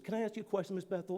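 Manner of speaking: put-on hushed question from a small boy; little boy asking softly. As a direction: ask softly, like a little kid. Five-year-old boy timidly asks his teacher.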